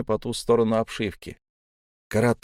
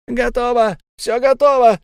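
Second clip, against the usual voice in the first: very high, loud frantic cry; hysterical; 12 semitones higher, 9 dB louder than usual. A narrator's reading. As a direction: shriek the news from below. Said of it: "послышался истеричный голос Зиана".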